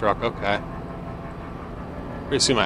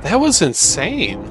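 amazed; 7 semitones higher, slower than usual. Real, not acted. After a scary in-game crash.

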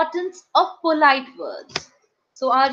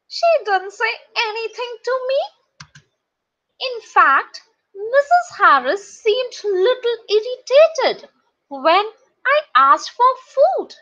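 put-on high-pitched storybook boy, put out; puzzled Peter; 7 semitones higher, 3 dB louder than usual. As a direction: pitch it up as a slighted schoolboy.

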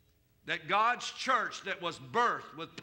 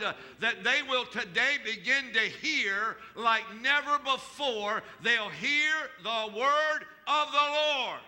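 rising, louder declaring prayer; fervent prayer; 3 semitones higher, 4 dB louder than usual. Real, not acted. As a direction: pray it over them, building in force. Prays over the congregation.

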